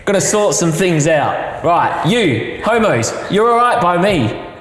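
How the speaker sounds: high, put-on English accent as God; a breezy English God dropping by. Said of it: "I don't know why I'm going English now".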